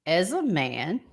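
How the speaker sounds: ordinary delivery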